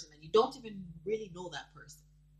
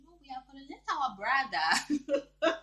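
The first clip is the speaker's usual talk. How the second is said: mock apology laughing; 6 semitones higher, 4 dB louder than usual. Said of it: "the whole video saying".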